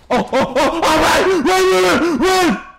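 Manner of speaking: high, loud, half-laughing panic; panicked yelling. Real, not acted. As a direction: yell to flee in a giddy panic. Panicked flight from enemy in game.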